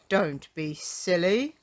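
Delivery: loud, slow scorn at normal pitch; scornful snort. A narrator's reading. Mister Uppity refusing the goblin's hole, snorting scorn. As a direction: dismiss it with slow, loud disdain. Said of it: "snorted Mister Uppity".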